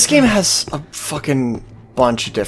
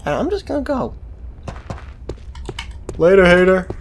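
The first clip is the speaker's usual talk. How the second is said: cheeky taunt; 6 semitones higher, faster than usual. Pretend. Player mocking game father.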